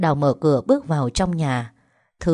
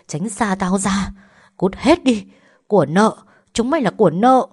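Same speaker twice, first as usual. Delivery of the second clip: furious cursing; 4 semitones higher, 5 dB louder than usual. A narrator's reading. Mother cursing her children as burdens, furious shout. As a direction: spit the curses at her. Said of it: "chửi bới cô".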